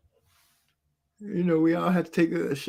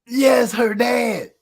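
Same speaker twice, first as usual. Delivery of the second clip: "Yes, her dad" shouted slow and high; slow, loud, disbelieving insistence; emphatic shout; 8 semitones higher, 4 dB louder, much slower than usual. Real, not acted. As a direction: hammer home that it was the dad. Insists on the shocking detail.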